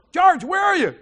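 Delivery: loud, high call into the dark house. Retold or imitated. Calling for George in the dark house, loud and high. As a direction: shout for George across the house.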